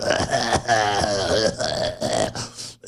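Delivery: low, drawn-out mock laugh; low imitation cackle; imitated laugh. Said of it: "just sit back and just go".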